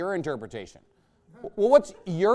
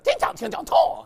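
much higher, sing-song mock Mandarin gibberish; 12 semitones higher, 4 dB louder than usual. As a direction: parody Mandarin tones as if angry.

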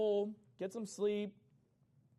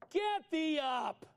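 commanding call; 6 semitones higher, 3 dB louder, much slower than usual. Acted. God commanding Joshua, read aloud.

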